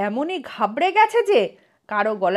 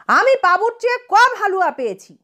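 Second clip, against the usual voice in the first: put-on higher, childish protest over the halwa; a boy mildly crying out that he got less; mild narrated yell; 9 semitones higher, faster than usual. A narrator's reading. Boy complaining about his share, lightly raised voice. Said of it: "চেঁচিয়ে উঠেছে".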